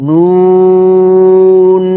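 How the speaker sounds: নুন held long as a loud nasal hum; ghunnah. Shown as a demonstration. Teacher demonstrating shadda on nun, long loud hum. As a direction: sustain the n of নুন for a full ghunnah. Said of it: "নুনের উপর তাসদিদ করে গুণনা".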